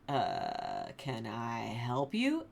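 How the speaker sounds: low, slow, deadpan reply; unimpressed general. Retold or imitated. Abner answering David's shout, low and slow deadpan. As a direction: drag it out like he can't be bothered. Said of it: "the general, is like".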